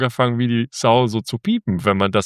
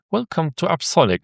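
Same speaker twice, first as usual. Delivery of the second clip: high tinny machine announcement; tinny speaker voice; 8 semitones higher than usual. Retold or imitated. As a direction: imitate a cheap tinny speaker. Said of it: "mit so einer richtig blechernen, billigen Lautsprecher".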